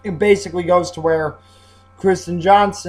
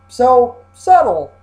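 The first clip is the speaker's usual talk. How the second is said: drawn-out mock praise, higher and louder; sarcastic; 5 semitones higher, 6 dB louder, slower than usual. Real, not acted. Reviewer mocking the volcano face, sarcastic. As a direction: slowly lay on the sarcasm.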